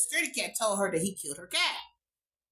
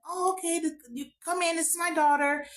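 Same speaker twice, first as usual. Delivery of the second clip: sweet lady voice; 7 semitones higher than usual. Retold or imitated. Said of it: "the lady was like".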